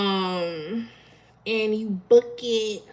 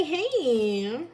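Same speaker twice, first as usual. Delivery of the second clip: half-hearted sexy voice; 2 semitones higher than usual. Shown as a demonstration. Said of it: "I can't even really do my sexy voice".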